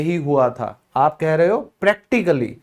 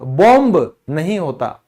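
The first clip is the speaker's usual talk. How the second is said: normal talking voice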